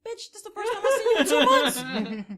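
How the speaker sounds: hammed-up high, loud outrage; outraged kid. Kid confronting the dad who rarely visits, mock-furious yell. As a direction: shout it in exaggerated indignation.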